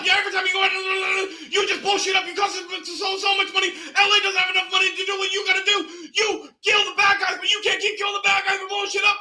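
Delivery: very high, furious shouting boss losing his mind; yelling police chief. Retold or imitated. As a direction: scream at your cop, building to total breakdown. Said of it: "This guy stands up every time he yells".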